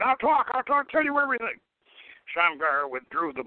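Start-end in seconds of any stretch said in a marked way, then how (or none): 0.0-1.6 screaming. A narrator's reading. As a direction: beg in terror. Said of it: "Naaman screamed".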